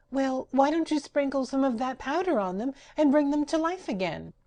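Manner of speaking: high, perky question; Patchwork Girl. Acted. Rag doll advising the magician, high and perky. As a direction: suggest it brightly.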